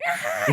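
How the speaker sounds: high laughing shriek; imitated movie scream. A horror victim screams on screen, laughing high shriek. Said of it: "and then".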